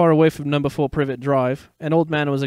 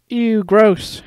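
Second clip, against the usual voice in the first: higher, louder disgusted yelp; disgusted cry; 8 semitones higher, 5 dB louder than usual. Acted. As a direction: blurt it out in disgust. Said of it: "Harry cried".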